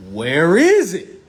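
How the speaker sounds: where is it? loud and slow, each word stretched; loud demand. Acted. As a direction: hammer the question out slowly and loud. Repeated rhetorical question to audience.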